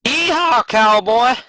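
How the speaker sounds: high-pitched mock cowboy holler; cowboy whoop.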